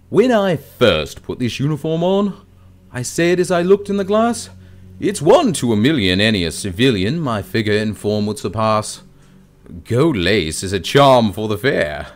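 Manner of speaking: over-the-top British accent. Shown as a demonstration. Actor reciting a warm-up monologue before going on stage, over-the-top British accent. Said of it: "it's kind of an over-the-top British accent".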